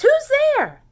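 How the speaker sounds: high, loud squeak of alarm; mouse cries out. Acted. Little mouse cries out at the eyes in the dark. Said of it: "Mouse cries".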